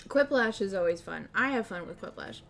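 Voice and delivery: normal talking voice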